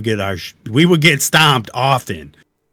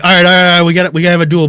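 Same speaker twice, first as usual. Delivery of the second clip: loud, raised, eager challenge; imitated excited voice; 7 semitones higher, 9 dB louder, much faster than usual. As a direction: act out his excited agreement to duel. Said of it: "his voice was so excited".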